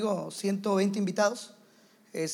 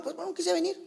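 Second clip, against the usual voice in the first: higher, careless excuse; cynical excuse; 7 semitones higher, 3 dB quieter than usual. Retold or imitated. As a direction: toss off a lame excuse. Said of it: "Se vuelve cínico". (excusa cínica, aguda y despreocupada)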